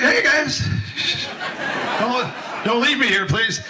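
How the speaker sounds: high laughing cry of don't leave me; half-laughing shout for help; mock yell for help. Teen deckhand yelling to the leaving boat, mock panic. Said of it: "I was like".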